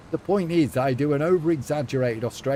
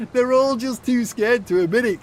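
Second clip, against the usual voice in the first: excited teasing; 10 semitones higher, 7 dB louder than usual. Real, not acted. Teasing the moderator, laughing.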